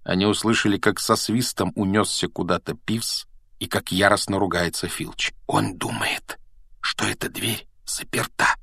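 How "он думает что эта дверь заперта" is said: hushed, much softer voice; whisper. A narrator's reading. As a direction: whisper it so nobody outside hears. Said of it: "прошептал Гарри". (шёпотом)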